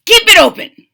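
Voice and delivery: high, loud command; yelled. Acted. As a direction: bark it at the fox. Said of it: "Doctor DeSoto says".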